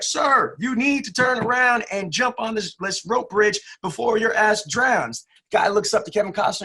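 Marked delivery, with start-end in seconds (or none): 0.0-5.2 urgent shout from helicopter. Retold or imitated. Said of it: "He's like".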